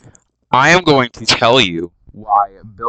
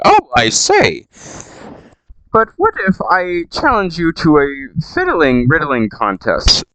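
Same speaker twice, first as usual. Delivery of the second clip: mock posh Bilbo; 6 semitones higher than usual. Bilbo challenges Gollum to riddles, high and prim. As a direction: propose it like a prim gentleman.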